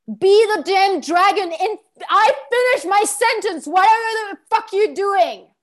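loud, high snap of anger; demo angry outburst. Shown as a demonstration. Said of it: "So say that".

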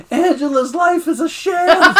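high, slow, laughing mock outburst; acting out someone cracking up; laughing gleefully, imitated. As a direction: squeal it through helpless laughter. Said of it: "then he just starts laughing".

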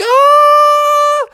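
high, loud falsetto scream; long frightened "aah"; nervous scream. A narrator's reading. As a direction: scream as you plummet. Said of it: "Bubby was getting nervous".

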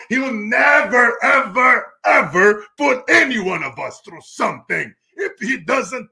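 real excitement building to a shout; fired up. Real, not acted. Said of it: "You get me fired up".

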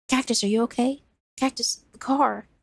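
put-on a frightened kid checking on his friend; worried young boy.

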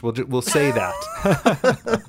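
breaking into laughter mid-line; words squeezed out through a laugh. Real, not acted. Laughing while talking.

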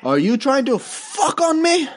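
hammed-up generic brown accent, a yelped No first. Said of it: "what accent I was trying to do there".

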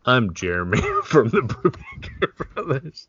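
ad intro breaking into laughter; mock ad read. Brother imagining a whiskey ad, cracking up. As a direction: crack up while introducing yourself as spokesman. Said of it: "if we get an advertisement with".

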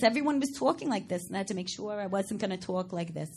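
imitating hometown Long Island talk; heavy mock Long Island accent. Shown as a demonstration. Actress showing the accent she dropped, Long Island accent. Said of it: "I had to lose the accent".

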